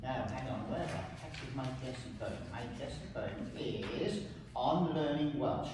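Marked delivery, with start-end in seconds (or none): none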